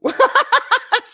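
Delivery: high, loud burst of laughter; big self-mocking laugh. Real, not acted. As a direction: burst out in a high cackle at yourself. Laughs at own low moods.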